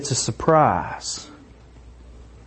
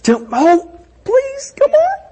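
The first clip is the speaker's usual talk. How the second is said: high, pleading excitement; childlike begging, rising higher; impatient hope pitched way up; eager pleading. Shown as a demonstration. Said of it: "you get up the next morning".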